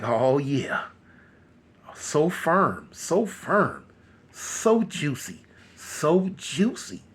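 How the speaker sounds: slow, low-key leering creep; creepy relish. Retold or imitated. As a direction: savour each word slowly, like a creep. Leering captor savours while touching.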